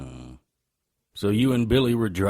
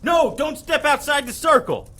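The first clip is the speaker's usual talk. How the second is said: high warning yell; urgent shout. Acted. Harry yelling to Susie to stay in the circle, urgent shout. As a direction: shout to stop her in her tracks.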